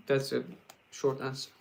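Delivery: ordinary talk, nothing marked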